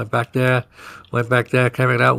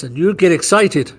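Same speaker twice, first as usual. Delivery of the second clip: ordinary delivery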